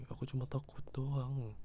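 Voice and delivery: usual speaking voice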